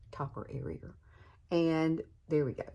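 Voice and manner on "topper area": "Topper area" repeated much softer, rising; quiet echo. Shown as a demonstration. To viewers, softly repeating "Topper area" as an aside. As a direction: murmur "Topper area" back to yourself.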